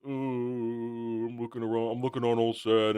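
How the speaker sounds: low, soft, dopey voice; lightly voiced sad wizard; mocking wizard. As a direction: moan it like a lost simpleton.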